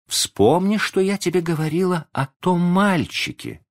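plain reading voice

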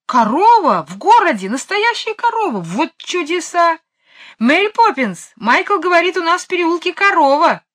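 very high, loud excited exclamations; amazed calling out. A narrator's reading. Jane hearing of a cow in town, amazed, calling Mary Poppins. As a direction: squeal with amazement, then call out the news.

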